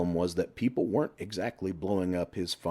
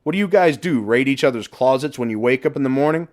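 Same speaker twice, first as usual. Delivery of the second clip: mocking shout; 6 semitones higher, 8 dB louder than usual. A narrator's reading. Man below mocking their shirts.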